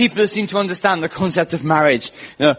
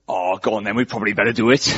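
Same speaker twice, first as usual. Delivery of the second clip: mock resigned giving-in; 8 semitones lower, faster than usual. Imagining giving in to an awkward advance, mock resigned.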